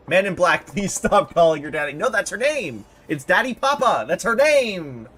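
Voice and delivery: loud, insistent acted protest; acted exasperation at full volume; exasperated outburst. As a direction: shout it across the argument. Acted quarrel over her name.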